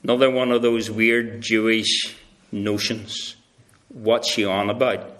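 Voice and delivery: low, soft, bemused aside; puzzled mutter. Retold or imitated. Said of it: "Ruth probably went".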